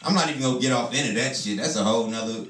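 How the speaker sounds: ordinary talk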